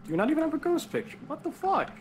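high, fast incredulous squeak; can't believe it, voice jumps up; shocked disbelief. Real, not acted. No ghost picture, disbelief.

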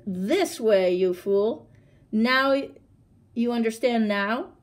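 loud, lightly acted impatience; exasperated tiger. A narrator's reading. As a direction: snap it out as a fed-up tiger.

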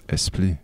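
esprit with a soft L-like R; Rwandan-style esprit. Shown as a demonstration. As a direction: say esprit as "espli". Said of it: "they kinda go towards a French".

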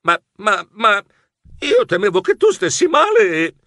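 stammering, rising pitch; stuttering mouse, confused. A narrator's reading. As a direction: stumble over the words, flustered. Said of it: "Balbettai confuso".

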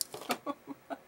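soft, wheezy laugh running out of air; real helpless laughter. Real, not acted. Vlogger overcome by the mushroom figure, laughing hard. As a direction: laugh till the voice gives out. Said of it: "I am laughing so hard".

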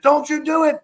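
very high-pitched mock yell; imitated scream. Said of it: "he's screaming at me".

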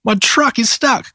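high-pitched puppy dismay; dismayed cry. A narrator's reading. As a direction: cry out in storybook distress, without shouting. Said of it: "cried Maxie".

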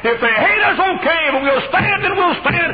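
very high, very loud rallying cry; preaching shout. Real, not acted. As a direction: call the church to stand with you. Final rallying call.

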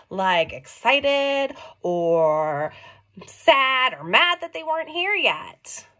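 ordinary delivery, nothing marked

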